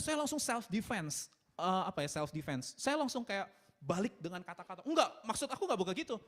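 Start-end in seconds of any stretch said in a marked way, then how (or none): 4.8-6.2 raised, defensive intonation. Acted. Husband answering his wife's suggestion, intonation rising defensively. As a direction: pitch shoots up in mock self-defense. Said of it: "langsung intonasinya naik".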